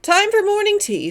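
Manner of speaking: high, brisk call to the group. A narrator's reading. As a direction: chivvy the party along smartly. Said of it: "said Anthony briskly".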